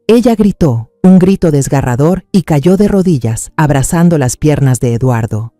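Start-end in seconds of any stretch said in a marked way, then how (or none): none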